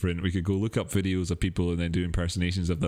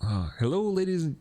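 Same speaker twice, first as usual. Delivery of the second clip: put-on abandoned Crypto Kirby accent; 8 semitones higher than usual. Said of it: "no, I can't do it".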